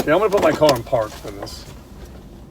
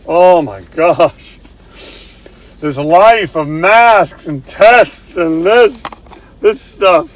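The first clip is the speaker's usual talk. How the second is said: exasperated; 2 semitones higher, 9 dB louder, much slower than usual. Real, not acted. Fed up with masks and tests.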